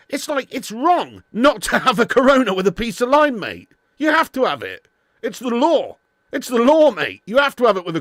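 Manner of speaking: mock outrage. Real, not acted. Streamer telling chat a Corona needs lime, loud mock-outraged insistence. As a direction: insist it's the law, half-joking.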